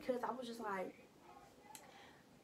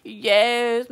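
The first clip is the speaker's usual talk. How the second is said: loud yes; 19 dB louder than usual. Retold or imitated. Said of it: "I'm like".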